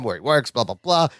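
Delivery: ordinary talk, nothing marked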